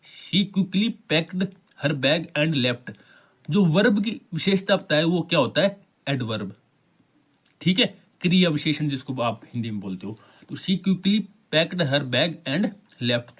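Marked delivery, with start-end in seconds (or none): 0.1-2.9 each word read out slowly, clearly; slow dictation reading. Shown as a demonstration. Teacher reads the example sentence to students, very slowly. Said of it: "क्रिया, यानी verb है यहाँ पे". 10.6-13.4 each word read out slowly, clearly; slow dictation reading. Shown as a demonstration. Teacher repeats the example sentence to students, very slowly. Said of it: "तो ये adverb".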